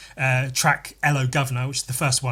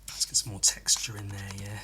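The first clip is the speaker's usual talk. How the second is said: whisper; 5 semitones lower, 8 dB quieter than usual. Real, not acted. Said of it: "muttering to himself while drawing".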